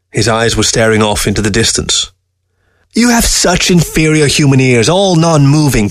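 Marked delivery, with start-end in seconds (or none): 2.9-5.9 higher-pitched grumble, slowing at the end; peevish, raised-pitch creature voice; complaining fox. A narrator's reading. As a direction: complain with exasperation. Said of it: "complained Fox".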